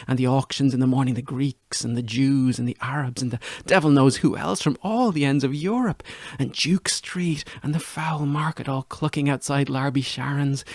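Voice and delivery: ordinary delivery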